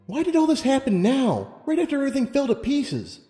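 high-pitched, distressed questioning; upset plea. Acted. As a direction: ask it in anguished bewilderment. Lumina upset, questioning her fate.

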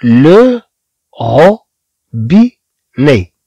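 لو روبيني drawn out slowly; teacher slowly introduces the new word; very slow model word. Shown as a demonstration. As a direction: stretch روبيني, each sound clear. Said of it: "ثم عندنا كلمة".

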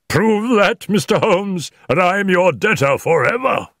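high, loud, overjoyed outburst; cry of joy. A narrator's reading. Husband hears his wife is innocent, crying for joy. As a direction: cry out with joyful, pleading fervour. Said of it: "with a cry of joy".

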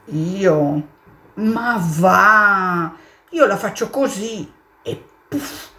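hammed-up horse scoffing. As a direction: laugh the question off. Horse answers the mole.

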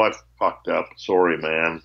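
low-pitched, half-hearted sorry; lame apology. Retold or imitated. Player who sold the guitar to his old friend, apologising lamely. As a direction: imitate a sheepish, token apology.

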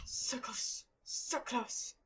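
tense unvoiced whisper. Real, not acted. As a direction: breathe it out under tension.

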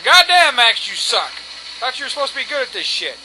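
loud, high-starting jeer; mock him like a viewer ribbing a player; acted-out teasing outburst; imagined heckling viewer. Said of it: "He'll probably laugh his ass off. He's going".

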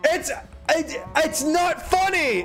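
mock outraged shout. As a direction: screech at chat in hammed-up fury. Fake outrage at chat joke.

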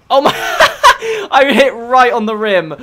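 high, loud helpless laughter; cracking up mid-sentence; laughing hard. Real, not acted. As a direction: squeal with laughter, then drag the words out through it. Laughing at own crash into rim.

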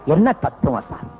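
low, hushed-awe fool voice; foolish admirer. Acted. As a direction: play a fool pretending to grasp deep philosophy. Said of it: "அசட்டு பைய தான் அசட்டு தனத்தை".

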